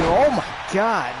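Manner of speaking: high, loud, drawn-out gasp of shock; surprised shout. Real, not acted. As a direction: react to the big move. Big move in the match.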